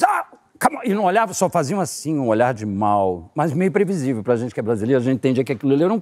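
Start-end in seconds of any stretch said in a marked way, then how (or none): 0.0-0.8 guard. Retold or imitated. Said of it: "aí eles".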